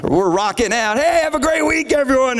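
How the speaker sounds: high, held-out cheery send-off; mock upbeat closing song. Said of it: "Then the last song is like".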